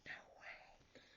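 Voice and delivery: barely breathed in disbelief; whispered no way in shock; stunned whisper. Retold or imitated. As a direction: whisper it, too shocked to speak. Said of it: "I was just like".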